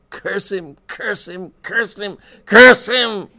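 high, bitter repeated curse, exploding loudly at the end; despairing hatred, pitched high; broken-down cursing. A narrator's reading. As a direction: build from strained cursing to an anguished yell. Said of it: "broke down, and in a low voice added".

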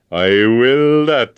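loud, slow, hearty promise; jovial. A narrator's reading. Agent cheerfully promising James to break the spell, jovial. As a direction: boom it out jovially. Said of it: "said Mister McKinnon jovially".

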